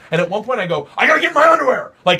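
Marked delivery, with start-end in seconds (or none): 1.0-1.9 high, loud mock meltdown; angry outburst. Retelling his audiobook as he rips pages, angry outburst. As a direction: yell it as if losing your mind. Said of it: "'cause I'm so angry".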